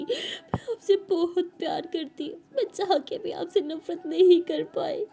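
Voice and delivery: high, loud, slowed tearful confession; crying. Acted. Daughter hugging her mother, crying out her love. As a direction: sob out the words of love. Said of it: "ज़ोरों से रोने लगती है".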